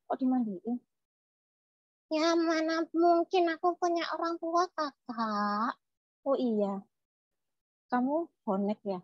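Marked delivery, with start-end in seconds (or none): 2.1-4.7 put-on high childlike doll voice; puppet child. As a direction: answer as a talking puppet. (suara boneka anak kecil)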